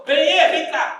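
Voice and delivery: loud, high call across the house; wife calling out. Retold or imitated. Wife calling her husband Benê to come, loud and high. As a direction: call the husband from another room. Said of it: "Ela foi lá e falou".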